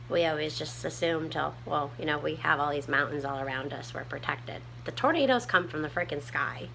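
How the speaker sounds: ordinary talk, nothing marked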